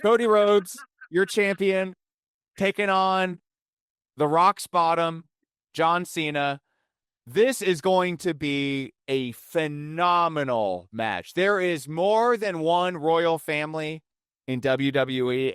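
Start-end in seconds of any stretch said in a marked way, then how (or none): 0.0-11.3 announcer. Acted. Announcing the match like ring announcer.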